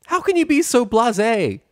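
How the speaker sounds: high, loud scolding shout. Acted. Pepper scolds Rainbow, high loud shout. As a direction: yell at her for being reckless. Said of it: "Pepper shouted".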